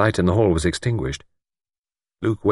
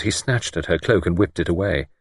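plain reading voice

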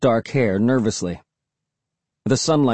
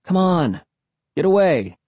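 higher, urgent command in two bursts; ordering; 6 semitones higher than usual. A narrator's reading. Boy tugging his dog away, ordering. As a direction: urge the dog off, pitch raised. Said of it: "I ordered".

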